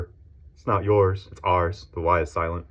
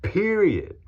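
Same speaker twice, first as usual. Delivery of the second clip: ordinary talk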